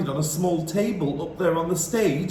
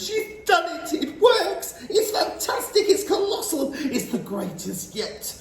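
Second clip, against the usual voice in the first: high, excited cheering voices; crowd of witches cheering; 6 semitones higher than usual. A narrator's reading. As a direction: cheer the leader wildly. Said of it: "yelled the audience".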